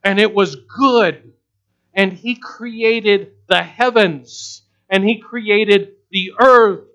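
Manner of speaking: loud, high, slow pulpit cadence; full-force preaching. Real, not acted. Preacher proclaiming creation to congregation, full-force preaching. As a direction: ring out each act of creation.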